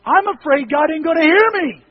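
high, loud impassioned retort; emphatic shout. Real, not acted. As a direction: holler it out to the congregation. Answers worry by demonstrating loud crying out.

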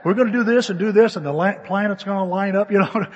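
put-on loud, hurried kid-like planning; excited third graders scheming. Third graders plotting to stay overnight at somebody's house, loud and eager. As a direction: rattle off the scheme, high and excited. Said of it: "like a couple of third graders".

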